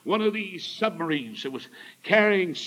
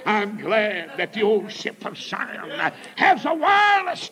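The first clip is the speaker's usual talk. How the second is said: slow, swelling revival cry; full-force preaching; 4 semitones higher, 6 dB louder, slower than usual. Real, not acted. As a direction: climb to a high, loud holler on the last words.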